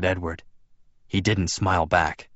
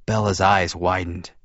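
ordinary delivery

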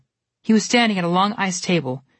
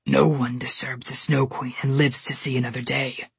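growling bear; 4 semitones lower than usual. A narrator's reading. Polar bear threatening Goldilocks, low and menacing. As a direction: rumble the threat down at her. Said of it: "the polar bear growled".

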